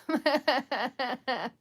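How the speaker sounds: long real laugh; rueful laughter. Real, not acted. Streamer facing an hour of bathing dogs, laughing.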